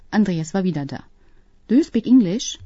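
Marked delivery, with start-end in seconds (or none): 1.7-2.6 higher, lightly called question; calling out. A narrator's reading. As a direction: call over to them, pitched up. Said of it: "rief er den beiden zu". (den beiden leicht zugerufen)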